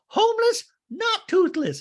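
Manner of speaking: very high, loud, exasperated parody; hammed-up outraged squawk; mock shrieked correction. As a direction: screech the correction like an offended patron. [name] corrects Kathy.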